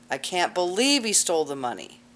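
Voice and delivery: "believe" lifted and stressed. Shown as a demonstration. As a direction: hit the word "believe". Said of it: "everybody emphasizing a different word".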